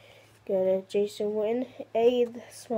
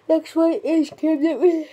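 raised voice over the noise; loud call; 6 semitones higher, 8 dB louder than usual. Real, not acted. Kid announcing his card over the chatter, loud. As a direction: push it out loud and high.

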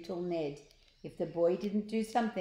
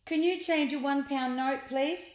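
Ned asking; 7 semitones higher, 3 dB louder than usual. Acted. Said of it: "asked the boy".

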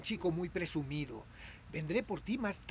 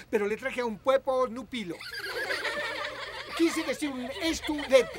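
flustered laugh; 7 semitones higher, 6 dB louder than usual. Acted.

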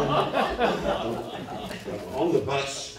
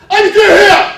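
huge high shout, every word hammered; booming yell; 26 dB louder, much slower than usual. Retold or imitated. Lineman yelling to his struggling teammates, booming shout. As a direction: roar it so the whole team hears. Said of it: "I mean, just yelling".

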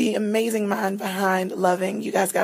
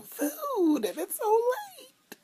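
mock whine; much slower than usual. Mock cry about no food.

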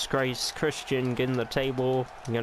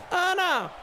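shocked cry; 4 dB louder than usual. Real, not acted. Shocked gasp at the action.

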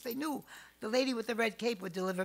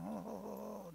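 ordinary talk

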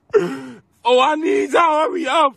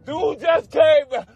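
panicked screaming; 2 semitones higher than usual. Real, not acted. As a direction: yell for your friends in panic.

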